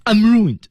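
"ruined" thrown up high, then falling; emotional keyword stress. Shown as a demonstration. Coach models emotion for student, "ruined" high and falling. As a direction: punch "ruined" high with feeling. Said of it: "当然情感也是需要的".

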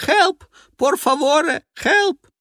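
high, loud cries for help; a drowning man yelling; desperate screaming. Acted. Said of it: "Мужской крик".

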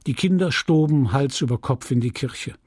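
usual speaking voice

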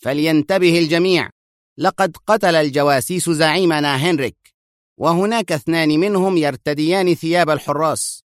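high, commanding announcement; stern command. A narrator's reading. Vondrik announcing over loudspeakers, stern command. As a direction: bark orders over loudspeakers. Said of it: "في لهجة آمرة صارمة".

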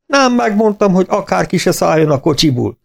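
spring the joke on the vet with a big grin; gleeful teasing. A narrator's reading. Farmer teasing the vet after the calves recover, gleeful. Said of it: "vidám vigyorban nyílt".

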